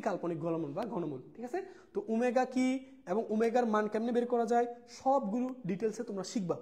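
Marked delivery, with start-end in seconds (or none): none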